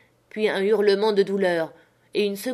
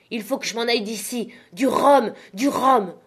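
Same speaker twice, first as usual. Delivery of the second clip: panicked demand; 4 semitones higher, 5 dB louder than usual. Acted. Panicked captain wants rum.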